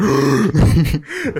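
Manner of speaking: shocked gasp. Retold or imitated. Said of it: "Я такой".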